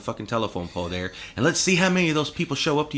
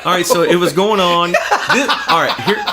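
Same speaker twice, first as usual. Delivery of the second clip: excited, laughing through the words; can't hold back laughter; loud laughing setup; 5 semitones higher, 10 dB louder than usual. Real, not acted. Laughing while reading post.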